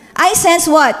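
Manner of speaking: ordinary talk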